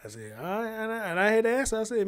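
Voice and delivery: ordinary delivery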